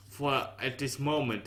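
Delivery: ordinary delivery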